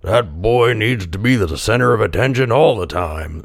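lightly voiced complaining villager; a hint of a disapproving villager; another villager. A narrator's reading. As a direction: grumble in a slight character voice. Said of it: "said another".

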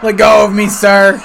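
put-on haughty, loud drunk aristocrat; drunken posh sneer. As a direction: shout it like a posh drunk being hauled off. Mock arrest scene, posh protest.

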